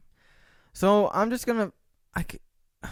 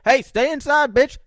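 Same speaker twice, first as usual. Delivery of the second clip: higher, louder mocking shout; mock activist yell; 10 semitones higher, 9 dB louder than usual. Coronavirus activist yelling at him to stay inside. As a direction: bark the order at him like a sniper. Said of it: "activist that's out there like".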